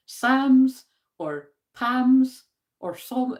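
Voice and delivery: slow "Psalms or Pams or Psalm", each sounded out; trying pronunciations. Shown as a demonstration. Unsure vlogger testing how to say Psalms, drawn out. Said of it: "I'm either saying".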